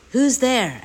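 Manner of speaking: loud, high challenge through a door; storybook old man calling out. A narrator's reading. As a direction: call out sharply to an unseen visitor.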